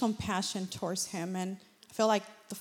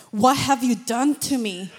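louder, astonished question; amazed cry; 3 semitones higher, 10 dB louder than usual. Retold or imitated. Healed client asking her what happened, louder amazed question. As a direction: voice his disbelief, much louder. Healed man reacting, retold.

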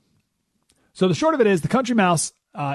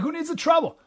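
much higher squeaky dismissive question; mouse's shrug; 14 semitones higher than usual. Acted. Country mouse shrugging off the city's danger, much higher. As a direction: toss it off in a tiny high mouse voice. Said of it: "So the mouse is like".

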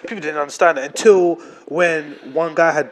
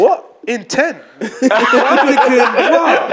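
higher, louder shocked what; laughing disbelief at the news; 6 semitones higher, 4 dB louder, much slower than usual. Retold or imitated. Retold shock at text.

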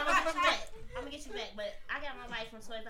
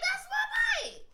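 high, loud mock yell with a laugh; laughing, hammed-up accusation; outraged kid; 5 dB louder, much slower than usual. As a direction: shout it like a kid spotting her bike. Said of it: "kid spotting her stolen bike".

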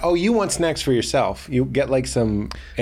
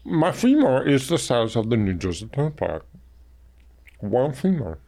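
giant voice; 2 semitones lower than usual. Retold or imitated. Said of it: "you'd be like".

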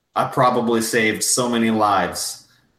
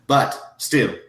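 usual speaking voice

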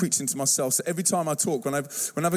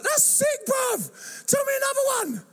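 very high, mock-excited kid shout; thrilled kids. Kids to the storyteller after David and Goliath, thrilled. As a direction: mimic amazed teens begging for more.

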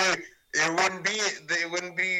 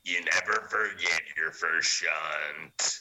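horror trailer voice; 9 semitones lower, slower than usual. Pretend. Parody of a horror movie tagline.

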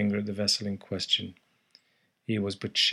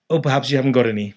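rapid, louder stranger's line; said quickly; 4 semitones higher, 9 dB louder, much faster than usual. Acted. Stranger quickly answering the narrator, fast and offhand. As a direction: rattle it off fast. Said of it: "he said quickly".

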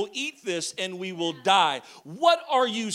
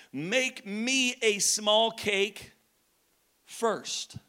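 slow drawn-out demand; much slower than usual. Acted.

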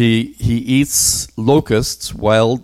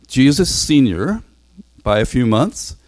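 ordinary talk, nothing marked

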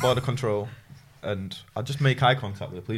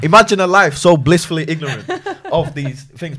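laugh-cracked and louder, amused disbelief; laughing through it; 6 semitones higher, 10 dB louder than usual. Retold or imitated. To the podcast hosts, laughing about his colleague's innocence. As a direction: let the giggles break the words.

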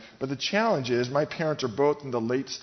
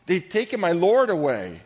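loud, raised-pitch frantic plea; 5 semitones higher, 7 dB louder than usual. Acted. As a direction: cry it out in desperation. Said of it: "She's frantic".

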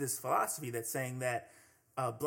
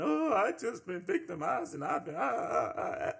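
hammed-up fake sobbing, voice pitched up; mock crying; 6 semitones higher than usual. Mimicking a woman crying to the police. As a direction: fake the waterworks with whimpering ahs. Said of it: "she's crying".

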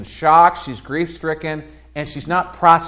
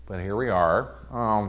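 normal talking voice

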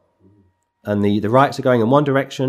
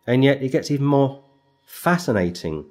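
normal talking voice

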